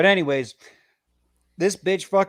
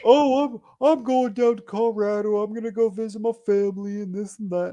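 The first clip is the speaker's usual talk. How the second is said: high, airy put-on girly voice; mocking ex-girlfriend; 6 semitones higher than usual. Mimicking ex leaving for Colorado, high mocking voice. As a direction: parody her breezy excuse, pitched high. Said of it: "And I was like".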